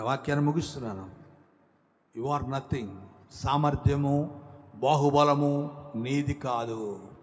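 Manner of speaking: normal talking voice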